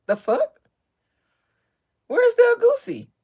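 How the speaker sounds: high, loud indignant outburst; husband outraged the princess can't cook; indignant husband rant. Retold or imitated. Ranting in character about cooking.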